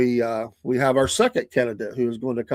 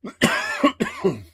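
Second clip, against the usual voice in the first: high laugh; 11 semitones higher than usual. Real, not acted. Laughing at the Rush story.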